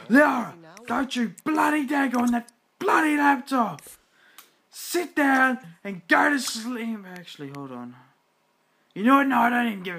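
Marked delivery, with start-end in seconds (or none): 0.1-7.0 furious shout in an Australian accent; angry Australian. Real, not acted. Player yelling at her sim child in an Australian accent. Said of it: "Whenever I get angry, I go Australian". 8.9-10.0 loud exasperated you know what; fed-up outburst. Real, not acted. Player giving up on her disobedient sim child.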